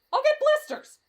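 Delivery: high-pitched protest; whiny complaint. Acted. Reluctant hiker complains.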